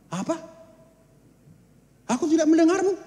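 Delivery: high indignant retort; angry God. Acted. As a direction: snap back in disbelief. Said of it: "Tuhan marah". (Tuhan membalas dengan marah)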